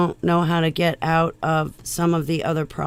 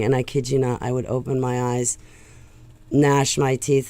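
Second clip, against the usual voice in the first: ordinary talk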